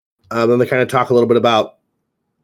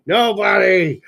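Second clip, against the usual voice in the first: dramatic shout; 8 semitones higher, slower than usual. Pretend. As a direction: belt it out like a movie line. Dirty Dancing line, echoed dramatically.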